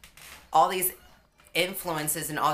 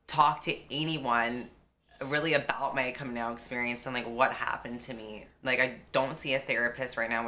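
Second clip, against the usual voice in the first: ordinary talk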